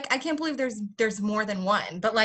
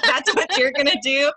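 louder, higher, laughing disbelief; incredulous echo; 4 semitones higher, 7 dB louder than usual. Retold or imitated. American echoes the parent's odd line in laughing disbelief. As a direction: question it loudly through a laugh.

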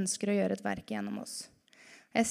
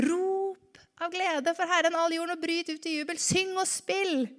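reading scripture; 6 semitones higher than usual. Acted. Quoting psalm, slow and high.

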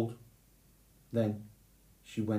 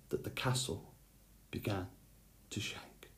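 whisper; 13 dB quieter than usual. A narrator's reading. Narrator hushes sleeping giant scene.

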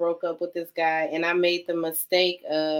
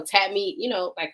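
ordinary delivery

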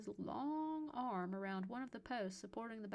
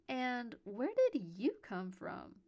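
playful, higher, slow teasing question; 4 semitones higher, 6 dB louder, slower than usual. A narrator's reading. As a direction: tease gently, drawing the words out. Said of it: "I asked playfully".

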